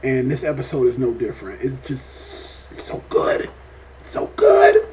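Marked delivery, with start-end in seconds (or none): 1.6-3.5 slow, hushed whisper; breathy whisper. Real, not acted. Gushing about how good the show is. 4.0-4.9 a much higher, louder squeal; excited squeal. Real, not acted. Gushing about how good the show is.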